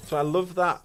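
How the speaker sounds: a clumsy try at broad Yorkshire vowels; bad Yorkshire accent. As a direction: put on a Yorkshire accent, badly and half-joking. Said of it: "that was an awful interpretation".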